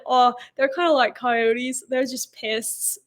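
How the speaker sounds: slow, lightly Australian attempt; lightly Australian, taking it slow; Aussie accent. Australian friend's words done for the podcast host, slow. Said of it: "He said".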